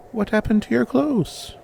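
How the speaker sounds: put-on high childlike question; young girl voice. A narrator's reading. Girl peppers the stranger with questions, high childlike voice. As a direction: ask it like a curious little girl.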